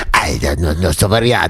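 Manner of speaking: low mock movie-villain voice; Nosferatu. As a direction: put on a creepy low foreign-villain voice. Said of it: "Very Nosferatu".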